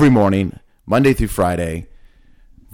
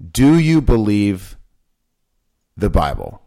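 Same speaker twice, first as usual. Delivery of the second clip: slow deliberate question; slower than usual. Real, not acted. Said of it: "too quick, so you probably don't".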